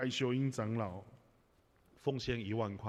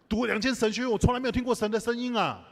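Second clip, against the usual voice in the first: much louder and higher, an astonished outcry; loud exclamation; 10 semitones higher, 9 dB louder than usual. Real, not acted. Pastor exclaiming to the church that he never heard God, loud. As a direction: cry it out in disbelief to the congregation.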